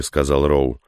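ordinary delivery

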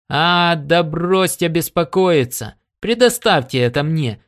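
breezily overconfident character voice; cocky retort. A narrator's reading. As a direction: wave the worry away with swagger. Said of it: "самоуверенно возразил Коннор".

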